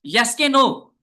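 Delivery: high, loud yes-or-no call. Real, not acted. Teacher calls out to wake up students. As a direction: demand an answer from the class. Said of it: "और भाई जाग रहे हैं ना?".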